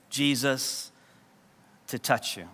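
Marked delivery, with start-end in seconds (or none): none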